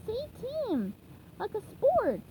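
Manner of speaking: patient teacher sponge; SpongeBob coaching. Shown as a demonstration. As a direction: coax it out slowly. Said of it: "SpongeBob coaching".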